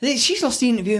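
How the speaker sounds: high puppet voice for Elmo. Retold or imitated. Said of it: "this is Elmo".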